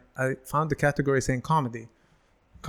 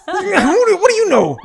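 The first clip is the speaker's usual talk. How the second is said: very high, loud indignant squawk; hammed-up protest breaking into a laugh; mock outrage; 11 dB louder than usual. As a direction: rant in comic indignation, pitch shooting up. Mock outrage at being cut off.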